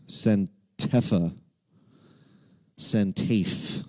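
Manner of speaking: "Santefa" and "Santafe" sounded out wrong, slowly; slow, halting misreading of "Santefa", then "Santafe" as one-syllable tafe; playful mispronunciation. Pretend. Deliberately misreads the city name.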